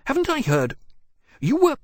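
high, loud, startled blurting; Willoughby suddenly remembers, breaks off; startled outburst. Acted. Willoughby recalls the scandal, blurting it out high and startled.

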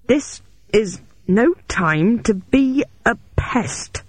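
grunting with effort. A narrator's reading. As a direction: push each word out while shoving. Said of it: "I grunted as I pushed".

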